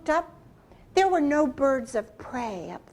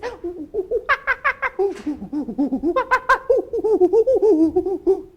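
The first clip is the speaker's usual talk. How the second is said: loud, high hooting monkey laugh; 7 semitones higher, 10 dB louder than usual. Acted. Monkey laughing in the trees, loud high hoots. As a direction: cackle like a wild monkey.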